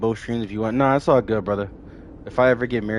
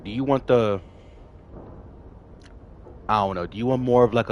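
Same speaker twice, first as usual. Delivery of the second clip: ordinary talk, nothing marked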